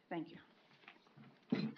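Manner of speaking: ordinary talk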